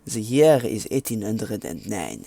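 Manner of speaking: put-on French accent, narrating. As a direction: read it out in a mock French accent. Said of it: "I'm gonna stop doing my French accent now".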